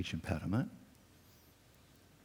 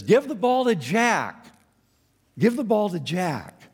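higher, louder slow yell from the sidelines; coach yelling; 10 semitones higher, 6 dB louder, slower than usual. Retold or imitated. Coach yelling to his losing team, mock shout. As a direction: call across the field. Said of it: "he's yelling to his team".

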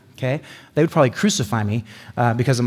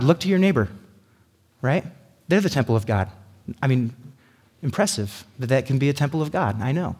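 usual speaking voice

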